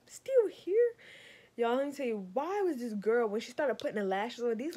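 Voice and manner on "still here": high, loud incredulous rise; disbelief. Retold or imitated. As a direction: ask it in disbelief, pitch climbing high. Disbelief on waking.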